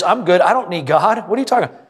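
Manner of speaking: a careless know-it-all shrugging off church; smug brush-off. Retold or imitated. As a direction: brush off the preacher like you're fine without God. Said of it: "The sentiment is".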